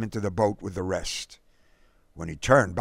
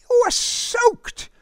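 put-on loud, high boyish exclamation; exclaiming; 5 dB louder than usual. A narrator's reading. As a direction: exclaim it high, startled at your friend. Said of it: "Derek exclaimed".

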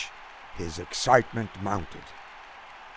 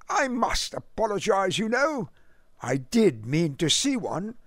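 put-on lofty British accent; 8 semitones higher than usual. A narrator's reading. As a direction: apologize politely in a clipped British accent. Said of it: "in his lofty, clipped accent".

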